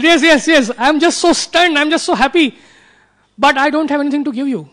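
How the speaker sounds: stunned happy queen finding no words; overjoyed Sita. Retold or imitated. Sita, hearing of Ravana's defeat, answers Hanuman with overjoyed disbelief.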